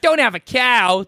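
high, loud cartoon kid voice; punchy, cheeky cartoon catchphrase; Odie. Podcaster jokingly voicing the dog Odie, high cheeky shout. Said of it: "That's Odie".

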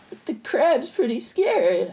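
high, slow, put-on timid voice; mock scared. He frets about the crab, high mock-timid voice. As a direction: whine it slowly like a scared child.

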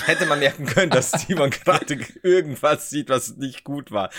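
laughing through the words; words broken up by giggles. Real, not acted. Laughing while telling about the recording mishap.

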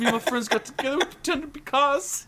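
very high, quick mock-Cockney; Michael Caine. Actor answering whether they were all cars, high mock-Cockney. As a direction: gabble as an excitable English film star. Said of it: "we're just talking about Michael Caine now".

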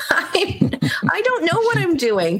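high-pitched mock helplessness, retold with amusement; flustered protest. Retelling her protest at filling in as psychic, flustered and high.

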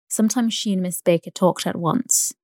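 usual speaking voice

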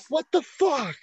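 high-pitched, incredulous outburst; shocked disbelief. Retold or imitated. Telling a friend about watching the stabbing, shocked disbelief. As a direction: relive your own appalled reaction. Retold shocked reaction to violent match.

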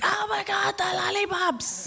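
put-on excited kids. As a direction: squeal it like a thrilled child. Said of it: "they go berserk".

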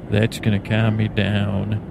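ordinary talk, nothing marked

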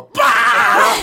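put-on very high, loud shout of kids; mock crowd roar. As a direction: burst out like a charging crowd. Four hundred kids rushing.

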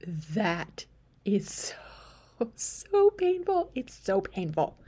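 retold labor pain, voice cracking upward; pained groan. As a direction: cry out the pain, drawn out.

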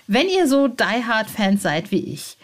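normal talking voice

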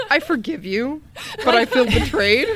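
mock betrayed. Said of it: "And I was just like".

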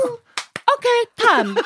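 high-pitched mimicked sign-off; masseuse. Masseuse ending the session, high mimicked voice. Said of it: "Then she goes".

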